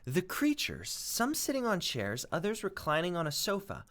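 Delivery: expressive read-aloud storytelling; story narration. A narrator's reading. Teacher reading a chapter aloud to pupils, expressive storytelling.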